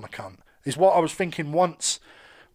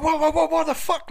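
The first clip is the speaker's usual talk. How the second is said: sudden high, loud what the fuck; a startled, retold yelp of disbelief; shocked outburst; 11 semitones higher, 3 dB louder than usual. Drunk man shocked when a stranger grabs him, higher and louder.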